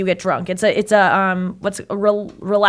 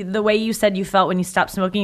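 ordinary delivery

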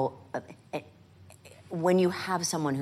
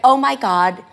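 loud, high burst of delight; sudden loud eureka; excited cry; 8 semitones higher, 14 dB louder than usual. Retold or imitated. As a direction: blurt it out thrilled. Director's excited exclamation retold.

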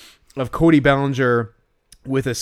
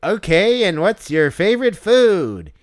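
slow, stilted interviewer, pitched up; canned hot dog host; 6 semitones higher, 4 dB louder, slower than usual. Acted. Hot dog character interviews a little kid, stilted and drawn out. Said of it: "that's a good impression that you're doing".

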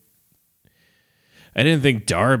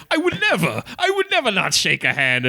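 squeaky mock indignation; 10 semitones higher than usual. Voicing indignant manager.

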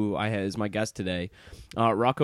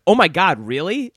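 higher and louder, imitating her delight; mock amazed gasp; 6 semitones higher, 5 dB louder than usual. Host retelling his date's reaction to Rocco, mock amazed and higher. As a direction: play her sudden recognition, thrilled. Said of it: "and she's like".

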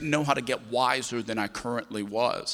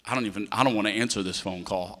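ordinary talk, nothing marked

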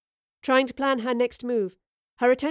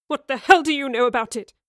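higher, louder furious outburst; angry scream; 4 semitones higher, 6 dB louder than usual. A narrator's reading. Young man rejecting professor's advice, angry scream. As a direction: scream it back at the adviser. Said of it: "Bosie screamed".

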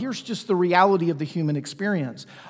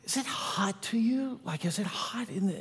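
whispered, puzzled question; soft whisper in the car; 3 semitones higher, 10 dB quieter than usual. Retold or imitated. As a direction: keep it hushed near the sleeping baby. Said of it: "I'm like".

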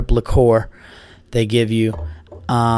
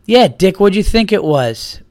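mock friend voice, higher and louder; imitating Bobby's voice; 6 semitones higher, 4 dB louder than usual. Comic retells Bobby Kelly teasing him, higher and louder. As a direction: lightly mimic a teasing buddy. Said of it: "his voice goes".

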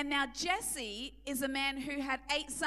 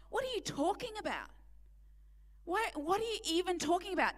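exasperated challenge; 4 semitones higher, faster than usual. Real, not acted. Preacher challenging the listeners.

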